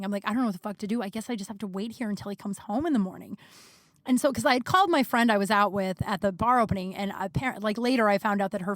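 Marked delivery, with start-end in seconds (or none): none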